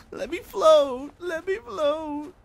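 drawn-out squeaky high plea; comic falsetto begging; high-pitched silly wail. Pretend. Silly plea while climbing.

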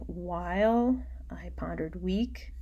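hushed, breathy reading of the alliteration; whispered line. Shown as a demonstration. Teacher whispering the line to show alliteration. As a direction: whisper it to let the w sounds stand out.

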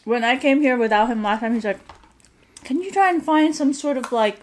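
eating between words; chewing while talking. Real, not acted. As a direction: mouth partly full of food. Seen 1: She ate fries just before and handles fries while talking.

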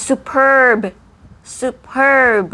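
"superb" with a high, loud, stretched PERB; big punched PERB in "superb"; over-enunciated. Shown as a demonstration. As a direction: exaggerate the second syllable of "superb". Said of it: "ด้วยรูปคำ และยังทำจมูกบานอีก".

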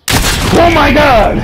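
slow, loud, high cry of shock; shocked shout. Real, not acted. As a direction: cry out in disbelief. Shock at a play.

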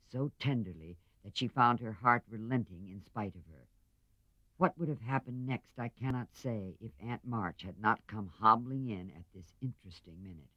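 ordinary delivery, nothing marked

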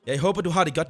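usual speaking voice

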